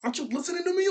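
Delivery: high-pitched mock scolding; playful imitation of someone getting mad; imitating mad. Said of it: "she was getting really mad".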